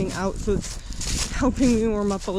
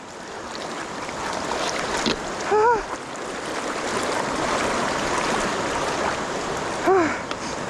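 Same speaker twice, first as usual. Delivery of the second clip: shaky cold exhale; 8 semitones higher than usual. Real, not acted. After deep crossing.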